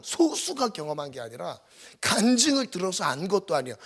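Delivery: ordinary talk, nothing marked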